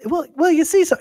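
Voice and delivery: put-on very high, loud, stammering child voice; caught kid. Caught kid trying to explain himself, high and stammering. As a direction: start explaining yourself in a high voice.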